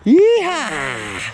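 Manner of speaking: excited whoop. Real, not acted. Triumphant after landing the find.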